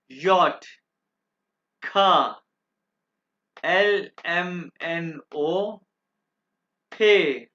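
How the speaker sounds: each letter said alone with a pause after it; teacher reciting German letters one by one; German letter names. Shown as a demonstration. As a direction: spell out the German alphabet slowly. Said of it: "दो दफा alphabets पढ़ूंगा".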